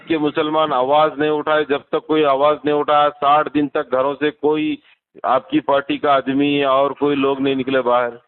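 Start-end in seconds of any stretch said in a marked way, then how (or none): none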